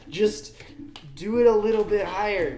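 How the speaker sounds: exasperated plea. Retold or imitated. Viewer bothered by the actress's lipstick, exasperated plea. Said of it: "I was like".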